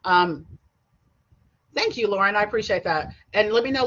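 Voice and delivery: usual speaking voice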